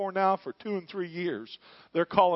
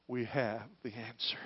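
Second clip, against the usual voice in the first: whisper; 4 semitones lower, 7 dB quieter than usual. Acted. Repeated line, whispered first.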